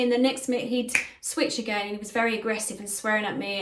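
ordinary talk, nothing marked

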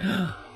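sharp high gasp of surprise; a startled intake of breath; surprised gasp. Acted. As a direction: Barabbas gasps hearing his name. Barabbas startled hearing his name.

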